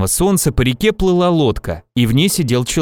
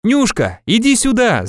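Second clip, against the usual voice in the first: calling back; 9 semitones higher than usual. A narrator's reading. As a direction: call someone over. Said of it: "закричал в ответ Иван". (высокий оклик в ответ)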